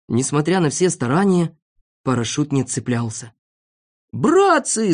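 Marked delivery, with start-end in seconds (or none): 4.1-4.9 high urgent call; alarmed shout. A narrator's reading. Doctor alerts friends to danger, shouting. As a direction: cry out to the whole group. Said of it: "закричал доктор Пилюлькин".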